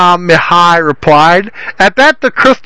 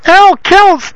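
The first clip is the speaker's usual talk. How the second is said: higher, scornful son's scoff; 11 semitones higher than usual. A narrator's reading. Son scoffs at his father's mention, higher. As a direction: sneer the name. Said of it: "Derek scoffed".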